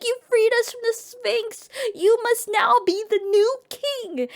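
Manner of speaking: high-pitched jubilant proclamation; mock celebration. Kingdom hailing the man who beat the Sphinx, acted jubilation. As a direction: proclaim it like a cheering crowd. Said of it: "the whole kingdom is celebrating".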